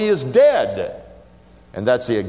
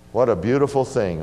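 ordinary talk

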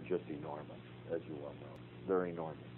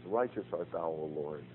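soft, hushed reading of a psalm as prayer; 4 semitones lower, 6 dB quieter than usual. Shown as a demonstration. Teacher shows class how he prays the Psalms, hushed. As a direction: pray the psalm quietly to the Lord. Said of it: "Just pray through the Psalms".

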